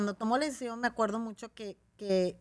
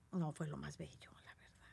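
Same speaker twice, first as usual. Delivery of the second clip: whispered aside; 5 semitones lower, 14 dB quieter than usual. Real, not acted. Confiding to the host about her haircut, whispered. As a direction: drop to a breathy whisper for the confession.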